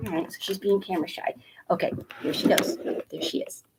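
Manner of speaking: fast whisper; whispered aside. Real, not acted. Owner commenting on her shy dog, fast whisper.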